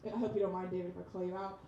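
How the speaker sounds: usual speaking voice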